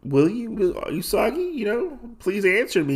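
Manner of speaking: high, halting plea; acted plea, pitched well up; anxious pleading. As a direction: beg for an answer as he walks off. Retold princess pleads as he leaves.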